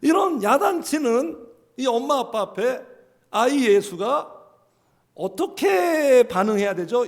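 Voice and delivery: ordinary delivery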